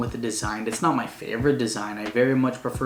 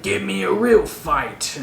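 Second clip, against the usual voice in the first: villain taunt; 7 semitones higher, 3 dB louder, slower than usual. Retold or imitated. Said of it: "It was like".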